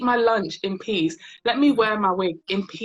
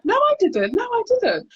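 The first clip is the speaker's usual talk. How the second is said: high, louder squeaky denial; mock flustered denial; 14 semitones higher, 4 dB louder than usual. Colleague denying the hair pull, high flustered mimic. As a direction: protest too much.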